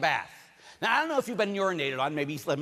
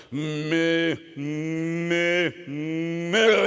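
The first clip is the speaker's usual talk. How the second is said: mooing calf; 4 dB louder, much slower than usual. Retold or imitated.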